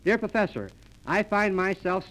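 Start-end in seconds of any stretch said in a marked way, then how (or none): none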